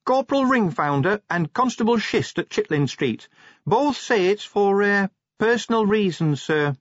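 high-pitched junior officer, reluctant to report; high, halting official report; Cheery, hesitant report. Acted. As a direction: pitch it well up, hesitating over the excuse. Narrator voices Cheery reporting.

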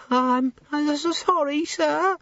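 put-on high, timid servant girl; nervous young maid. A narrator's reading. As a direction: stammer it out, frightened.

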